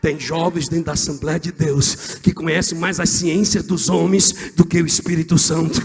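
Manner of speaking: loud, high preacher's cry building to a shout; full-force preaching. Real, not acted. Preacher rousing his church about the flame that cannot go out, full-force shouting. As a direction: preach it at the top of your voice, climbing to the end.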